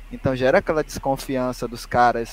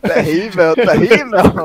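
mock outrage; 6 semitones higher, 10 dB louder, much slower than usual.

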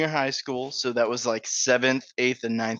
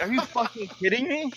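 much higher, incredulous; disbelief; 11 semitones higher than usual. Retold or imitated. Kid slipped in gravy reacting, incredulous. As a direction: squeak out the disbelief. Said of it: "I was like".

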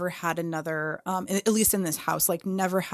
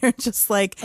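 ordinary talk, nothing marked

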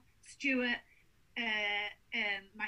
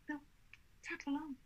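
ordinary delivery

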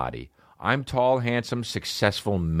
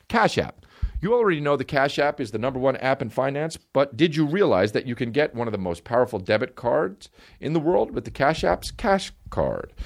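upbeat pitchman sponsor read; bright podcast ad-read energy; 4 semitones higher than usual. Acted. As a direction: sell it like a radio spot. Host reads a sponsor ad.

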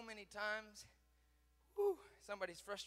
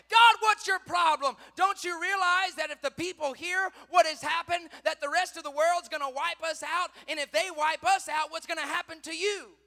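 high, loud protest, easing slightly toward the end; Joshua; 9 semitones higher, 18 dB louder than usual. Retold or imitated. Joshua to God after his army's defeat, blaming shout. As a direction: shout blame at heaven. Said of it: "on his face blaming God".

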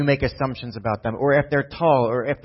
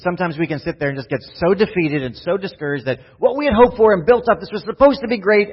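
rising higher and louder into a near shout; building preach; 6 semitones higher, 6 dB louder than usual. Real, not acted. Pastor rising to a peak over lost hopes, louder and higher.

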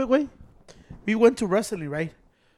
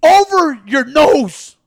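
loud, high, drawn-out mock order; yelling lady; 5 semitones higher, 9 dB louder, much slower than usual. Imitating the lady correcting how he wears it, yelling. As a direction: stretch each word in an imitated scolding yell. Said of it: "the lady keeps yelling at them".

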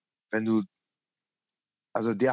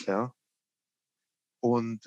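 normal talking voice

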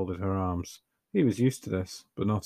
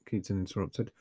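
plain reading voice

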